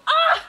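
high loud yelp; shocked scream. Real, not acted. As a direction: yelp when soaked. Water poured.

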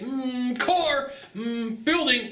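lightly voiced distracted buyer, high and drifting. Coach mimicking buyer dodging a hard question, lightly high. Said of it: "So they're like".

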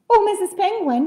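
high, loud eager chick; little bird crying out. A narrator's reading. Little bird hails a giraffe, crying out. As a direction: call out hopefully as a small bird. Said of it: "he cried".

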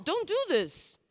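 normal talking voice

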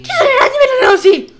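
fast, high, loud warning; panicked gamer shriek; alarmed yell. Real, not acted. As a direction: blurt it out in alarm. Alarm at spiders in game.

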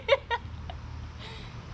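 high unvoiced nervous giggle; breathless giggle. Real, not acted. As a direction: giggle under your breath. Nervous laughter during cut.

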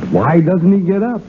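normal talking voice

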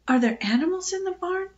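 high, timid question; fearful. A narrator's reading.

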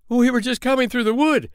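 high, loud and breathless storytelling; thrilled to tell the tale; eager telling. Acted. Tailor tells the gathered crowd about the bear, excited. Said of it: "said the tailor".

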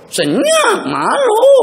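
high, bashful voice; shy bride. Acted. Preacher acting the bashful bride of old, high and shy. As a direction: act the shy girl going silent.

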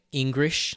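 English said as "Engrish"; English with R for L, drawn out; mock Japanese-accented pronunciation. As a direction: swap the L in English for an R. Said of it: "racist depictions of, like".

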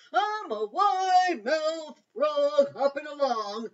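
high, loud, slow cartoon frog. A narrator's reading. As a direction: boast like a cheerful big-mouthed frog. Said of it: "said the wide-mouthed frog".

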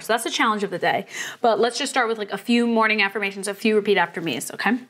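normal talking voice

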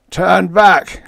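high, loud cry of alarm; panicked shriek. A narrator's reading. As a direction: shriek the order in terror. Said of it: "Lowen shrieked".

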